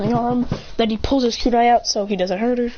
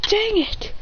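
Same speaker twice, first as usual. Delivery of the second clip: high annoyed cry; 10 semitones higher than usual. Acted. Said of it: "He goes".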